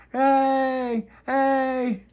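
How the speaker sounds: very high cheering hey; squeaky crowd cheer. Acted.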